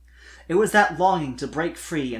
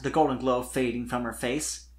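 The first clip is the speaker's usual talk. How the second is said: ordinary delivery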